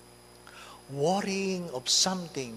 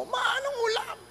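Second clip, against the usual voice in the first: put-on high shouted child's call for food; a child's mock shout from the next room. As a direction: yell it up at mum like a hungry kid. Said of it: "Ang bata sumisigaw".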